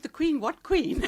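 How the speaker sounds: high, loud mock disbelief; childlike astonishment, played up for the story; baffled retold question. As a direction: blurt it back, pitched right up, puzzled. Retelling her baffled childhood reply.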